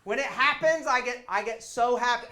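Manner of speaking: high, loud, gushing; squealing with joy over a stage trick; giddy defense. Retold or imitated. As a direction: gush about it like a kid. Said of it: "And I was like".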